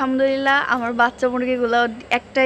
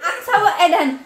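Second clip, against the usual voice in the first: giggly teasing voice, pitched up; 8 semitones higher, 6 dB louder than usual. Real, not acted. Teasing baby Aksa, laughing.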